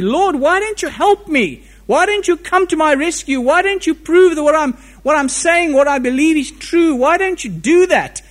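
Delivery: a desperate man pleading to heaven; anguished plea. Acted. Voicing the psalmist's desperate plea.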